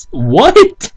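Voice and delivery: high, slow stunned what the fuck; baffled. Real, not acted. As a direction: drag out the disbelief, way up high. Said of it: "reacting to a garbled word".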